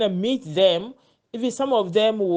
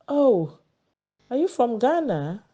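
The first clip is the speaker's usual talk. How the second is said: mock surprise; 6 semitones higher than usual. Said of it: "he was like".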